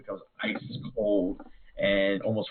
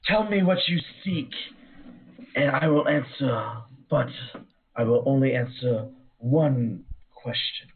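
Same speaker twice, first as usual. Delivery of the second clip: eerie whispered banshee, swelling high; 4 semitones higher than usual. Acted. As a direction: breathe it out like a ghostly spirit. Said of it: "how to do a Banshee's voice".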